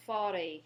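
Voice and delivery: forêt repeated after the pause; forêt, open final e, silent t; French forêt. Shown as a demonstration. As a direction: confirm forêt for the learner. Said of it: "Go ahead and say that one".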